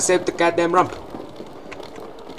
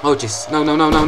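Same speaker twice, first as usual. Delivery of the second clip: ordinary delivery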